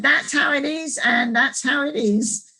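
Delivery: put-on Australian accent. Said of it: "they all laughed like anything and said".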